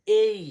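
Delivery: "এই" loud and high, gliding e to i; A as a glide. Shown as a demonstration. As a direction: punch out "এই" as a long ay-ee. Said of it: "এটাকে পড়ব". ("এই" জোরে, টেনে উচ্চারণ)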